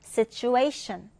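very slow situation, stress on si and A; situation with secondary and primary stress. Shown as a demonstration. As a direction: spell out situ-A-tion slowly. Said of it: "이 강세, 그리고 일 강세 지켜서 읽어볼까요". (situation 이 강세와 일 강세 지켜 천천히)

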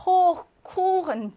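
호크 and 쿠chen stretched slow and loud; 호크, 쿠chen with long, loud vowels. Shown as a demonstration. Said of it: "예외적으로 길게".

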